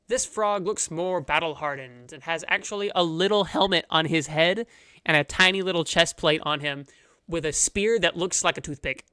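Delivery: ordinary talk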